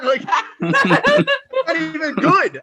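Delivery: slow, high laughing; amused disbelief. Real, not acted. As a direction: laugh the words out slowly. Laughing at bad prediction.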